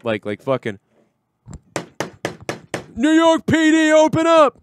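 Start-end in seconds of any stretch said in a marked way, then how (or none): 2.9-4.6 high, loud mock police bellow; police shout. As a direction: bang on the door as cops. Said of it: "the next thing you're gonna hear is".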